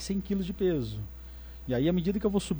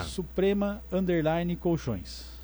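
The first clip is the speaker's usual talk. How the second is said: slowly; much slower than usual. Real, not acted. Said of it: "vou falar devagarzinho".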